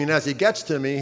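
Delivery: ordinary talk, nothing marked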